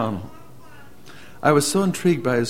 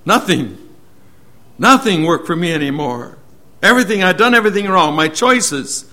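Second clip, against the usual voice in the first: fast, higher, louder lament; a retold man blurting out his regrets, raised and fast; despairing outburst; 4 semitones higher, 8 dB louder than usual. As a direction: rush out the regret loudly. Preacher voicing despairing man.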